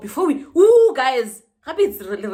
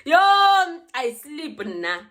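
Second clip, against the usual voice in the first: drawn-out boast; 6 semitones higher, 5 dB louder, much slower than usual. Real, not acted. Boasting about her nap.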